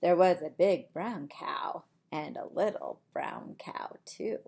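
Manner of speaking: lively storybook voice for little ones; reading to children. Acted. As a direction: read it like bedtime story time. Reads picture book aloud.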